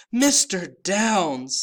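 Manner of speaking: high, slow, loud squeaky elf voice; high-pitched elf. A narrator's reading. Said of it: "in a high-pitched voice".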